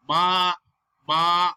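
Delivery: "ma" loud, long and level; sustained "ma" with no pitch movement; flat first tone. Shown as a demonstration. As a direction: hold ma on one steady pitch. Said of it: "keep your voice flat like ah".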